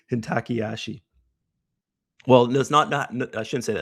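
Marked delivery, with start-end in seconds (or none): 0.1-1.0 Hintakiyashi said as hin-TACK-ee-ASH-ee; own foreigner pronunciation. Shown as a demonstration. Trader showing viewers how he says it, foreigner pronunciation. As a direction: say Hintakiyashi in a plain foreigner's way, HIN-tacky-ashy. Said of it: "it's how I say it".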